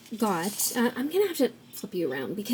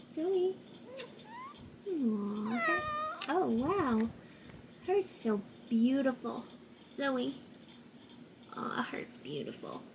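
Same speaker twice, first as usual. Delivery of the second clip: cooing to the cat; 4 semitones higher, 11 dB quieter than usual. Real, not acted. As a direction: coo gently at the cat in a half whisper. Cooing over her cat Zoe.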